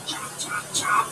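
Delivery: breathy hushed chopping words; whisper. A narrator's reading. Storyteller whispering the chopping sound. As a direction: whisper it like a secret.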